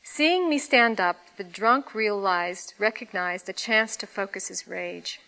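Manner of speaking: usual speaking voice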